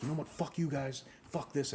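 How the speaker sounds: a light retelling of someone blowing up; imitated angry outburst. Customer cursing out the store staff, mildly acted anger. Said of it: "he gets so fucking angry".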